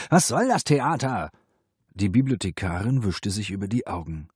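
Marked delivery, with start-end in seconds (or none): 0.1-1.3 high, louder irritated question; cross, raised comeback; nagging retort. A narrator's reading. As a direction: snap back in a quarrel. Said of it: "keifte er zurück".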